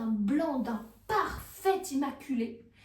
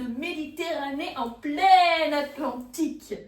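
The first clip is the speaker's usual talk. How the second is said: exclaimed climax; 8 dB louder than usual. A narrator's reading. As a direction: stretch it out loud in amazement. Climax of absurd triumph. (exclamation forte et étirée)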